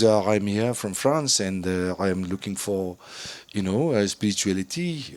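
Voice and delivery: low, soft French-accented imitation; failed French accent. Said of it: "that French accent didn't work".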